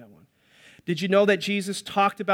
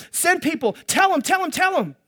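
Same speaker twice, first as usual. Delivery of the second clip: much higher, louder, urgent pleading; God pleading; 7 semitones higher, 4 dB louder than usual. Acted. God urging the prophets to tell his people, raised pleading voice. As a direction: beg like a desperate father.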